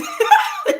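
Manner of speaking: high laugh bursting out; burst of laughter. Real, not acted. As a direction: laugh out loud at the answer. Laughing at Baba's answer.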